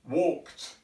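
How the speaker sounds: walked ending on a breathless tongue-only t; clipped ending. Shown as a demonstration. Teacher applying the breathless t to the past tense. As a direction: finish walked with no voice on the t. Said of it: "This would enable you to pronounce".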